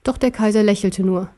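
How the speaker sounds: plain reading voice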